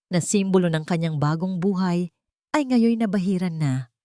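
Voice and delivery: ordinary delivery, nothing marked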